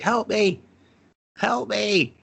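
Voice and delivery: high, begging cry for help; mock pleading. Man dangling from the platform begging for help, high mock plea.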